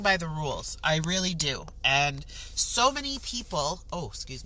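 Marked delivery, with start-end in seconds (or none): none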